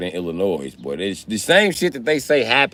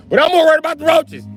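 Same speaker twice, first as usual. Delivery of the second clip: louder, higher, faster; high loud emphatic punchline; 6 dB louder, faster than usual. Real, not acted. Telling the crowd his real fear, high loud and fast.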